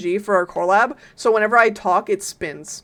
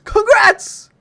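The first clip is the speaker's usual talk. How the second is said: high, loud cheer; celebratory shout; 11 semitones higher, 6 dB louder than usual. Real, not acted. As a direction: burst out with congratulations. Cheers the bingo winner.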